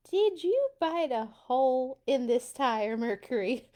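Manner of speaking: gruff farmer scolding the cat; mock-annoyed grumble at the cat; grumbling farmer. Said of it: "the farmer grumbled".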